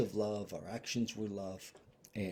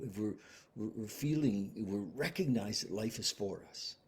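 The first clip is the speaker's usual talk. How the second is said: normal talking voice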